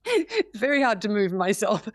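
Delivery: words bubbling with real laughter; laughing. Real, not acted. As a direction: laugh through the line, amused at herself. Laughs at own acting attempt.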